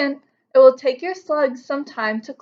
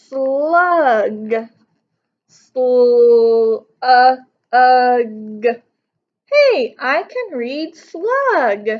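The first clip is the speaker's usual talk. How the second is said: slow, louder slug voice stretching the sounds; slug voice sounding out; 6 dB louder, much slower than usual. Acted. Slug character reading slug for the first time.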